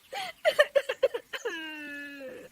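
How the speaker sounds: high, theatrical sobbing; crying. Acted. Daughter-in-law alone under the sky, bursting into sobs. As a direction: break down sobbing after the complaint. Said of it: "वो रोने लगती है".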